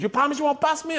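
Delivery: high-pitched suspicious question; high, needy impression of a buddy; mock wary friend. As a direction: ask for a promise in a high mimic voice. Acted wary friend asks promise.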